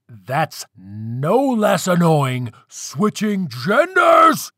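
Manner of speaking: slow, building shout of exasperation; screamed. A narrator's reading. Father snapping at his pestering daughter, screamed. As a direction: stretch it out, climbing to a high yell. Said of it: "Antu screamed".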